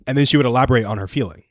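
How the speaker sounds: ordinary talk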